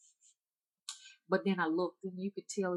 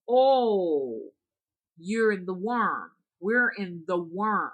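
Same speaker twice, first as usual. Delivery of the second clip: louder, slow and emphatic; insistent old woman; 3 semitones higher, 9 dB louder, much slower than usual. Retold or imitated. Hunchback woman insists, slow and loud, to a lost visitor. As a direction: spell it out to a slow listener.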